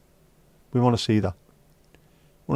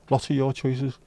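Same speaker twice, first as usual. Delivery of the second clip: ordinary talk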